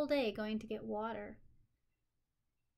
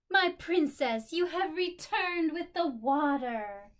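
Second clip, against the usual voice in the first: proud greeting; 6 semitones higher, 11 dB louder, slower than usual. Acted. Father greets returning daughter.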